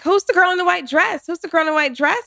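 high-pitched, eager calling question; curious clamour. Retold or imitated. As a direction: imitate an excited red-carpet crowd asking. Retold clamour on red carpet.

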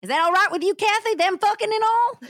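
very high, loud Southern holler; over-the-top Southern outrage; hillbilly accent. Acted. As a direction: holler it like a hillbilly on a talk show. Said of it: "a hillbilly accent".

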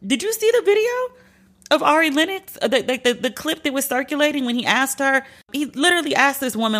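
incredulous. Real, not acted. Host to listeners about the clip, incredulous. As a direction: disbelief rising into outrage.

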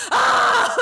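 high laughing scream; shrieking yelp breaking into laughs; re-enacted scream. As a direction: scream through your laughter. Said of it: "okay, I'm screaming".

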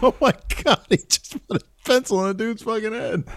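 high-pitched, through laughter; laughing retelling. Real, not acted. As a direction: barely hold back laughter as you tell it. Laughing while retelling a scene.

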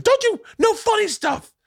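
imitated high-pitched warning; old school Bobby. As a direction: warn the driver in a hammed-up yell. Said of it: "this is old school Boston Bobby".

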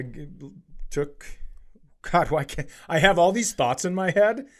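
ordinary talk

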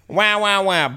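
hammed-up baby wail; mock baby crying. Mocking a whining baby.

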